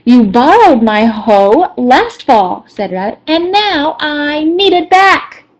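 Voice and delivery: accusing. Acted. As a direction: press the blame home. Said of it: "very accusing".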